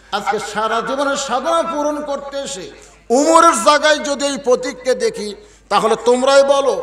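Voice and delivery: high, loud appeal; impassioned pleading. Acted. Shepherd appealing to the companions, loud impassioned plea.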